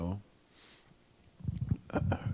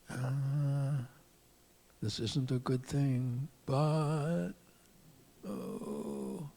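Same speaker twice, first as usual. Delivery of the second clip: soft, higher voice, wavering aloud; tempted musing; 5 semitones higher, 4 dB quieter, slower than usual. Acted. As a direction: muse softly as temptation wins you over. Bilam tempted by the reward.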